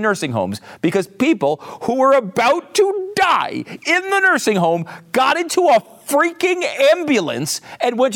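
high-pitched, exasperated tirade; angry rant. Real, not acted. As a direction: hammer each point in mounting outrage, pitched high. Exasperated rant at politician's lying.

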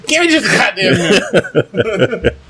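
high, slow, laughing plea; amused begging for one minute of peace; slow laughing exasperation. Retold or imitated. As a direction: drag it out through laughter, fed up.

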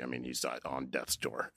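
quiet, emotional retelling; choked up. Real, not acted. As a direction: voice dropping, holding back tears. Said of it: "I'm, like, choked up".

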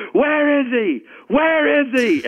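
very high, strained holler; hammed-up high-pitched demand, repeated; mock outraged hollering. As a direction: call out as if hunting down the culprit. Imitating singer storming in hunting him.

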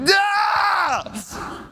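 long, high-pitched mock tantrum cry; sustained high wail of fake fury; imitated frustrated scream. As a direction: imitate a toddler losing it. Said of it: "she's getting so frustrated".

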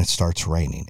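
normal talking voice

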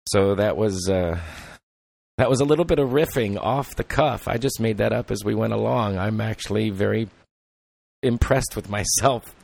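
ordinary talk

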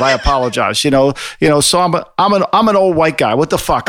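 normal talking voice

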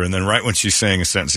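normal talking voice

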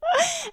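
sharp high laugh; high burst of laughter. Real, not acted. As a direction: laugh out suddenly.

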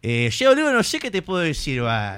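high, loud Argentine accent; exaggerated Argentine accent. Shown as a demonstration. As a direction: open big with a porteño lilt.

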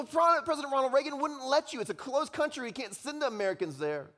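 high, insistent explanation; objecting student. Acted. Student explains Reagan's ban to Hauerwas, high exasperated objection. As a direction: argue back, pitched well up. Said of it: "He says".

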